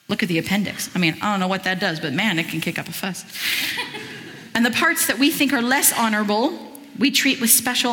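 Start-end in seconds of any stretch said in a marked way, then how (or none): 3.3-4.5 breathy laugh. Real, not acted. Laughs at appendix joke.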